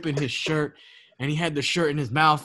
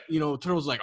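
usual speaking voice

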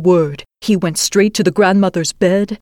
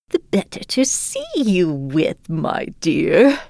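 wolf playing granny; slower than usual. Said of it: "she said".

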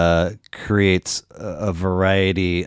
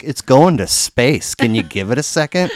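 incredulous exclamation; 5 semitones higher, 4 dB louder than usual. Retold or imitated. Comedian scolding spoiled passengers, incredulous exclamation. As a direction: marvel that it goes to space. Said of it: "It's like".

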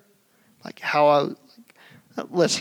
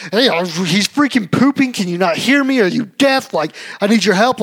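louder, higher irritated outburst; mock exasperated rant; 5 semitones higher, 6 dB louder than usual. Dad's imagined retort to wife, higher loud exasperation. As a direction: vent it as a frustrated rant. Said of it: "what I wanted to say was like".

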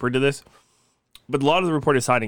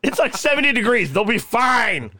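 mock outraged insistence; 9 semitones higher than usual. Podcaster defending leaving the kids outside, mock outraged.

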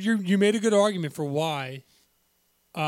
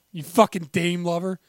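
normal talking voice

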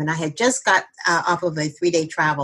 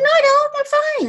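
high, louder mock protest; defiant denial; 3 dB louder than usual. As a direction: push back insisting you're fine. Refusing the doctors' intervention.

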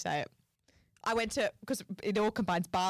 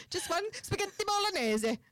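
high, hammed-up Italian accent; sing-song put-on Italian, pitched well up; mock Italian accent; 10 semitones higher than usual. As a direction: order like a fake Italian. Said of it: "start speaking Italian".

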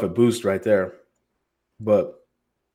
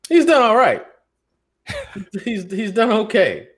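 ironic understatement; 12 semitones higher, 3 dB louder than usual. Real, not acted.

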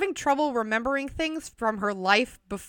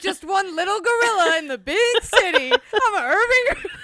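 high, giggling riff; can't stop laughing; 10 semitones higher, 5 dB louder than usual. Real, not acted. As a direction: squeal the joke out through laughter. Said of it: "I can't stop laughing".

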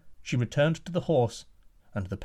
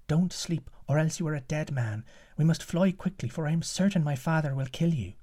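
put-on higher urgent young woman's voice; giant's daughter; 4 semitones higher than usual. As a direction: warn him in a higher, hushed urgent voice. Daughter warns the prince.

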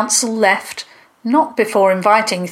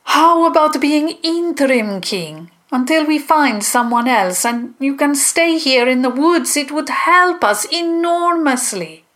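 high-pitched character voice making an offer; high, insistent councillor's plea; monster councillor, persuading; 7 semitones higher than usual. A narrator's reading. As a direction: coax a reluctant candidate, pitched up.

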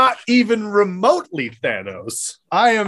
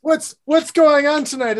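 high, lilting Irish cartoon leprechaun accent; touch of mock-Irish leprechaun; Lucky Charms accent; 8 semitones higher than usual. Host opens the show, sliding into Lucky Charms Irish. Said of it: "you were doing Lucky Charms".

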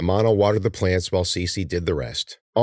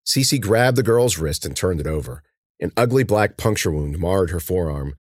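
usual speaking voice

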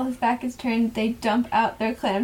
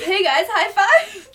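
higher, louder, drawn-out cheery call; acted excited greeting; 8 semitones higher, 5 dB louder, slower than usual. Host acting out Tyler greeting the boys, excited. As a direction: call it out like an eager high-five offer. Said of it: "and Tyler says".

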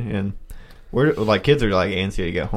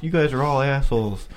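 mock principal lecture; 5 semitones higher than usual. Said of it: "lecturing us".

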